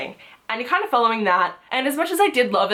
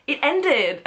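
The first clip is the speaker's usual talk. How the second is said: higher, slowed words breaking into a laugh; amused laughing; 6 semitones higher than usual. Real, not acted. Reader admitting she was sad it ended, amused laughing. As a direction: laugh at your own silly complaint. Laughs at own reason for sadness.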